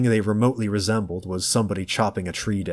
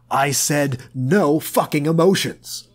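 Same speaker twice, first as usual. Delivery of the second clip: slow, furious shout, higher and louder; angry yell; 6 semitones higher, 4 dB louder than usual. A narrator's reading. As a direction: bark each word in rage. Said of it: "Tom yelled".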